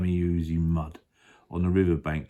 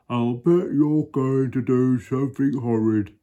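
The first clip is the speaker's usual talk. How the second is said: higher hippo voice, suspicious; Humpy Rumpy; 5 semitones higher, 4 dB louder than usual. Acted. Hippo suspecting the crocodile, high and accusing. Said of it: "said Humpty Rumpy".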